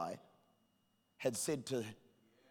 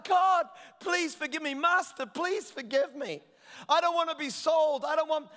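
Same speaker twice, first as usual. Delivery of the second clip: high, urgent acted begging; pleading; 13 semitones higher, 9 dB louder than usual. Preacher acting the servant begging his master, high pleading. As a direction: beg for mercy in a raised preacher's voice. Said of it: "he's pleading out to God".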